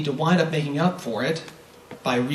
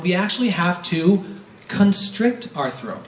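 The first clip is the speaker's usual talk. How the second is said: ordinary talk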